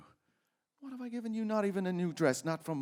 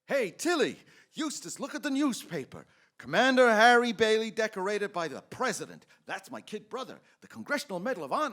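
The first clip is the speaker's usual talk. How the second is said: loud, higher, bursting with news; excited; 6 semitones higher, 8 dB louder than usual. Acted. George calling to the office staff about his brother, excited. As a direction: call out the headline with pride. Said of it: "George is pretty excited".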